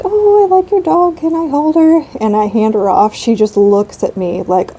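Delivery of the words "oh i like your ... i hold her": high, sing-song dog admirer; gushing stranger. Retold or imitated. Stranger asking to hold the little dog, high gushing voice. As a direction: coo it much higher, like a delighted passer-by.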